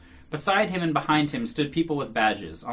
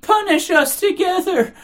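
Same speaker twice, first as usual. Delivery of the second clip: very high, loud, drawn-out wail; frenzied exclamation; 13 dB louder, slower than usual. A narrator's reading. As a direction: plead at full frenzy. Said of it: "exclaiming frenziedly".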